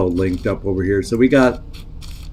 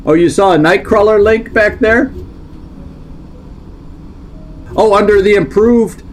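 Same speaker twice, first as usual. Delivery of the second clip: surprised; 9 semitones higher than usual. Real, not acted. Surprised at viewer's tip.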